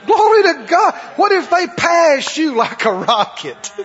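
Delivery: mock praise shout. Preacher mocking our response when others are blessed, loud. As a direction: shout it like a forced church cheer.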